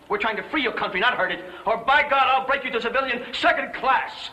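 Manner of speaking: high, hard, angry dressing-down; furious tirade. Acted. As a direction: tear into him without letting up. Ike furiously berates General Giraud.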